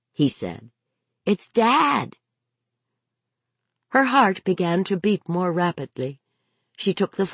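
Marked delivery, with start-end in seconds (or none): 1.2-2.2 put-on high-pitched child voice; little boy announcing, pitched up; young boy. A narrator's reading. Boy telling his mother who is calling, high child voice.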